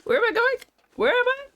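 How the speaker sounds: high, lost and flustered; confused panic. Retold or imitated. Streamer retells being lost in the game, mock panic. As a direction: act out being totally lost. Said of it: "I was like".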